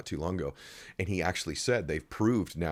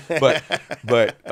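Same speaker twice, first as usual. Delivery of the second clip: louder, breaking into laughter; laughing; 5 semitones higher, 10 dB louder than usual. Real, not acted. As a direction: laugh mid-sentence.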